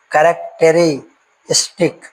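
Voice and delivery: Characteristic said slowly and clearly; slow syllable demo. Shown as a demonstration. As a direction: spell characteristic out in slow parts. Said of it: "example हम लोग देख लेते हैं".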